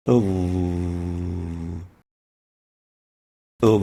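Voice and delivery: تمرين ظ; drills of ظ. Shown as a demonstration. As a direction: practise the heavy ظ slowly. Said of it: "the back and the sides of the tongue goes up".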